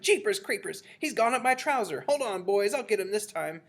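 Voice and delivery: high, loud, startled cook; shouted. A narrator's reading. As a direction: holler to the others in a fluster. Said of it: "the cook shouted".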